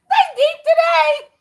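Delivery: high, slow, laughing through the words. Real, not acted. To her viewers about the reading, laughing.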